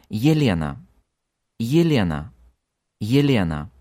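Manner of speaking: Yelena starting with ye as in yes; Yelena: clear ye at the start; initial Ye. Shown as a demonstration. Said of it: "sounds like English yes without S".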